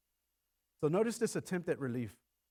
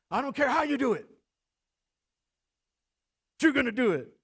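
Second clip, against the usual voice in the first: loud, high angry dad voice; 7 semitones higher, 8 dB louder, faster than usual. Retold or imitated. Preacher imitating a mad dad ordering his kids. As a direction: lay down the law like a mad dad. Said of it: "wax like a dad".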